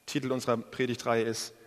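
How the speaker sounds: normal talking voice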